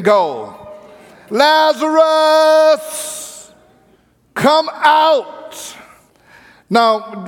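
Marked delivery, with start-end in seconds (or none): 1.3-5.7 high, long drawn-out call of Lazarus; loud call. Acted. Jesus calling Lazarus from the tomb, long ringing call. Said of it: "He said in a loud voice".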